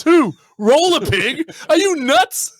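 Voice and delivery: high, incredulous outburst; hammed-up horror, pitch leaping up; appalled disbelief. Healy to Ted about looking her up, appalled.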